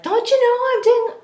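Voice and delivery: high, loud imitation of yelling at the kids; loud, high-pitched mock scolding. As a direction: yell it in hammed-up exasperation. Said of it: "yelled at them".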